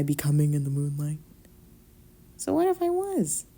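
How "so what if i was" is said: high, drawn Southern Belle lilt; Southern Belle accent. Acted. Southern Belle accent teasing her roommate in bed. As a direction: play it like a coy Southern Belle. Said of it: "What accent was that?".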